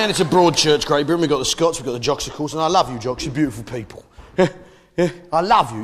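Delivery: ordinary talk, nothing marked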